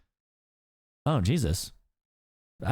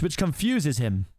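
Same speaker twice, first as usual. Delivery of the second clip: usual speaking voice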